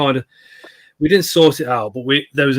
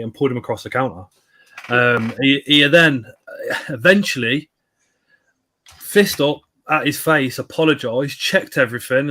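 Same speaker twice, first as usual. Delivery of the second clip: usual speaking voice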